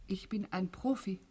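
soft hushed whisper. Acted. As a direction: whisper it close. Hushed exchange between two.

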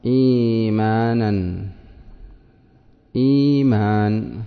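إيمان said slowly with a long ii as ii-maan; teacher models إيمان with its long ya; drawn-out long vowel. Shown as a demonstration. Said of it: "فصارت".

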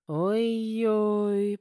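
long, dismayed "oy-oy" moan; drawn-out groan. A narrator's reading. Husband seeing the pregnancy, dismayed groan. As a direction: groan it out on one held note. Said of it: "простонал Матвей".

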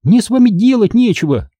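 high, cross dismissal; angry retort. Acted. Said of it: "сердито бросила Минди". (сердито, высоким голосом)

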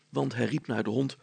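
usual speaking voice

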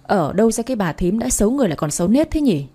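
ordinary delivery, nothing marked

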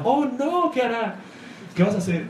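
squeaky high mock alarm, teasing the villains; mock panic. Jay and Alan mocking the terrorists, in high mock alarm. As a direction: pretend to be scared while laughing at them. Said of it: "ellos dos muriéndose de la risa".

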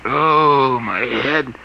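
groggy groan. Acted. Prisoner coming to after drugging.

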